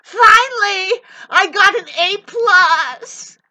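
loud, high, drawn-out mock triumph; gloating mock voice. Ranter mocks a narcissist gloating loudly over finally winning. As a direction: sneer at them crowing over winning. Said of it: "you're playing a game of".

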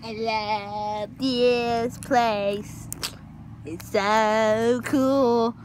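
very slow, stretched words; drawn-out awe. Acted. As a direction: savour each word in mock wonder.

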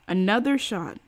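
ordinary delivery, nothing marked

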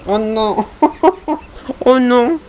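high "oh no" through laughter; laughing dismay. Real, not acted. Player reacting to the dialogue's drama, laughing dismay.

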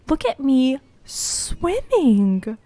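slow, stretched repeat in a put-on voice; drawn-out. Real, not acted. Player repeating the line slowly in a goofy voice. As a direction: stretch every word, savouring it.

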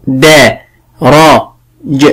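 "دا، را، ج" in separate, unhurried sounds with pauses; calm sounding-out. Shown as a demonstration. Teacher models spelling درج for a pupil, slowly sounded out. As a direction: break the word calmly into "دا", "را", "ج". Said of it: "ولكن بهدوء".

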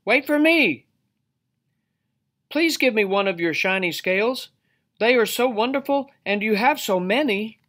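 high, eager little fish voice; calling little fish. A narrator's reading. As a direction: plead in a high-pitched small-creature voice. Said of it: "he called".

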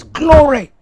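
high loud cry of glory; shouted glory. Retold or imitated. Temple worshippers crying glory together. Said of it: "everyone says".

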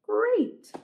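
plain reading voice

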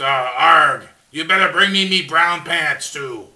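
low, gruff pirate growl. Retold or imitated. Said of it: "He goes".

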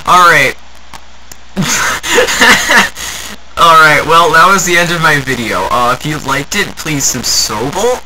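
loud, jittery wrap-up with a laugh; loud outro. Acted. YouTuber rattled after losing, bellowing his outro. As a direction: blurt out the sign-off way too loud.

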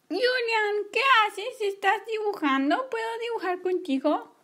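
high, eager little sister; little sister asking to draw along, high and eager; little girl voice. Acted. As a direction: pipe up like a small girl asking to join in. Little sister Emma asks.

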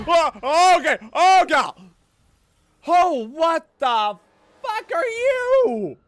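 high, loud alarmed cries; panicked shouting. Real, not acted. Panics at game monster.

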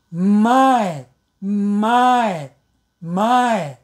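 slow "my" with a held m at the start; demo word. Shown as a demonstration. Teacher modelling the word my. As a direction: linger on the m of "my". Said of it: "like this".

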